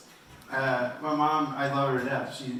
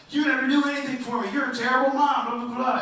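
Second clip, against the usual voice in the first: put-on much higher, louder whiny kid yell; hammed-up four-year-old tantrum; four-year-old screaming at his mom about the movies; mock tantrum; 13 semitones higher, 7 dB louder than usual. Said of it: "I just started screaming and crying".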